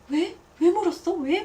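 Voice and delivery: pry eagerly like a nosy friend. Retold or imitated. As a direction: friend demanding details, loud and eager. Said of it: "막 물어봐요".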